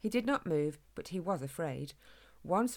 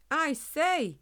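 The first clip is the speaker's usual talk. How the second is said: high, raised-voice call for attention; a little louder; 9 semitones higher, 5 dB louder than usual. A narrator's reading. Hedgehog tries to get the humans' notice, louder. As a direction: speak up to be noticed. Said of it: "only this time a little louder".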